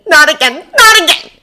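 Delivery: loud, high, climbing plea; panicked shout rising to a peak; escalating panic. A narrator's reading.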